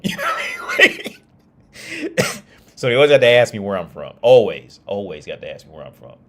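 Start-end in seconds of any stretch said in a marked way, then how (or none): 0.0-2.8 laughing. Real, not acted. Laughing at his own joke. 2.8-4.1 laughing. Real, not acted. Telling friends about locals, loud laughing. 4.2-4.8 "Always" punched much louder; emphatic. Real, not acted. Stressing how locals always ask, loud "Always".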